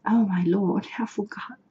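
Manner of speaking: breathless whisper to herself. Real, not acted. Reader whispering to herself after forgetting the word. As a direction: drop to a bare whisper, as if scolding yourself.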